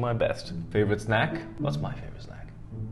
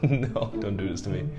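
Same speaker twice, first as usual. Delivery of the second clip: laughing protest; 6 semitones lower than usual. Real, not acted. Host denying what just happened, laughing protest. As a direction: protest helplessly through the laughs.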